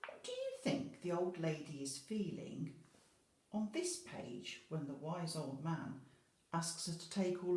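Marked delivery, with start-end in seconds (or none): none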